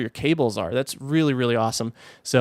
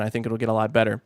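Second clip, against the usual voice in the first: usual speaking voice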